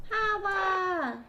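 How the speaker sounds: high, loud holler; excited holler. Real, not acted. Greeting the game character with a loud excited holla. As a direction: throw out a loud playful holla.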